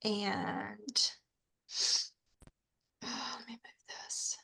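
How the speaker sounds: losing voice, barely voiced. Real, not acted. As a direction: voice fading to a whisper. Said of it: "I'm losing my voice".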